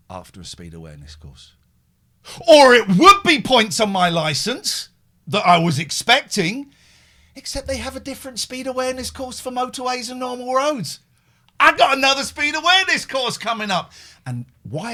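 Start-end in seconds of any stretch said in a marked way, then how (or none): 2.5-13.9 high, pushed comic outburst; insistent high-pitched crowing; mock-triumphant rant. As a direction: hold it up high like a proud announcement. Comic boast about more courses.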